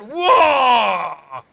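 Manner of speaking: loud startled whoa; loud shocked shout. Real, not acted. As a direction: shout as the cars touch. Sudden crash reaction.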